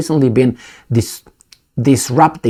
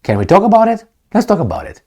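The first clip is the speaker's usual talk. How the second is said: higher, friendly mock voice asking to talk; mock reasonable neighbour; 8 semitones higher than usual. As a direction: play the tough guy turned polite. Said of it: "Normal meaning".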